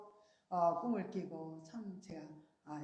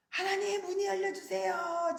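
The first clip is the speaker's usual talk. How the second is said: high, loud pleading cry to God; 11 semitones higher, 5 dB louder than usual. Retold or imitated. Dreamer crying up to heaven for the door, loud plea. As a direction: call up to heaven, begging. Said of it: "막 소리를 치는데요".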